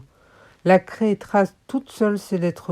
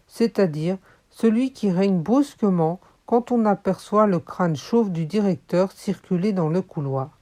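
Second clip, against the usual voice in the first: usual speaking voice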